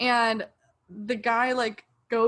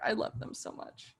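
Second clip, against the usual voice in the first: whispered; 8 dB quieter than usual. Real, not acted. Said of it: "fan confessing love".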